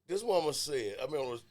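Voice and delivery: ordinary talk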